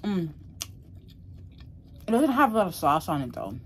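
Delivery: chewing while talking; talking between bites of meat; mouth full. Real, not acted. Seen 1: bites rib just before; jaw and lips working on food throughout.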